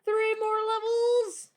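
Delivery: high, loud and slow; excited shout. Real, not acted. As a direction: call it out with glee. Nearing end of dungeon, exclaiming.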